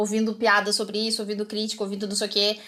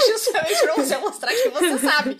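higher, louder, breaking up with laughter; laughing retelling; 5 semitones higher, 4 dB louder than usual. Retold or imitated. Retelling the examiner's reply at the driving test, laughing hard. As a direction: tell it through real laughter.

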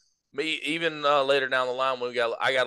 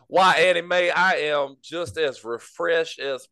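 slow, hammed-up Southern lady accent; mock Southern belle. Sipping Coke on the porch, in a hammed-up Southern belle voice. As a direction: call out grandly like a porch belle. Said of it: "that old plantation Southern accent".

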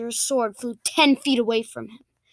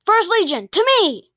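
loud, high military shout; rallying call; 8 semitones higher, 6 dB louder than usual. Acted. Captain summons the First Legion, rallying shout. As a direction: call the troops to you, loudly.

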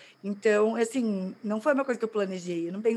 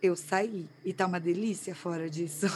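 gasp, then soft amazed realization; hushed acted wonder at being free; awed relief; 3 semitones lower, 5 dB quieter than usual. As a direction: breathe in sharply, then marvel quietly, ending in a laugh. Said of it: "você faz assim".

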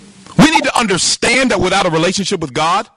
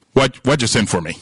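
low, offhand mock bewilderment; comically puzzled; 10 semitones lower than usual. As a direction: undercut the grand speech with a low, clueless question. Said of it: "he's got all this conviction why he came, and he's like".

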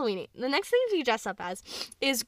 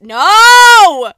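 long, high, very loud cry; drawn-out yell of protest; alarmed scream; 9 semitones higher, 21 dB louder than usual. Real, not acted. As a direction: scream it to stop them. Alarm at nail polish being opened.

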